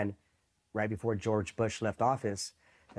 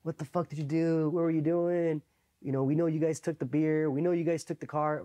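raised-pitch pressing questions; imitated interrogation; 6 semitones higher than usual. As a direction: play an MP grilling suspects. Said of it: "again, interrogating us".

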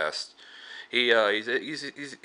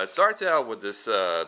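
ordinary talk

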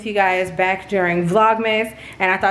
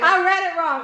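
laughing; 9 semitones higher than usual. Real, not acted. Host laughs at own mistake.

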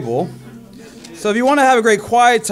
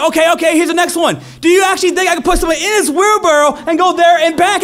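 hyped showman; 6 semitones higher, faster than usual. Retold or imitated. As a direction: whip the crowd up. Showman hyping crowd.

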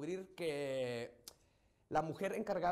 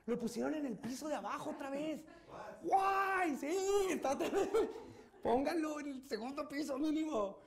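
acted gleeful shouting, pitched way up; mock excited cheering; 9 semitones higher than usual. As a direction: squeal it like an overjoyed schemer, half laughing. Mock cheering in Chapo tunnel joke.

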